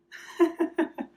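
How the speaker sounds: bright high laugh at the dog; high giggle. Real, not acted. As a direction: giggle at the pet. Dog interrupts filming.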